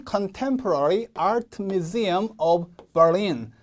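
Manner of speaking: very slow English title, Berlin stressed on the second syllable; model pronunciation. Shown as a demonstration. Teacher modelling the museum's name, slow careful English. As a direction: read "Contemporary Art Museum of Berlin" slowly, word by word. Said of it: "Berlin 이렇게".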